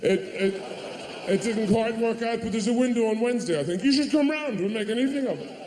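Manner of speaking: high, manic cheerful parent; high hammed-up frazzled voice. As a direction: brightly pretend it's all fine. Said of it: "You go".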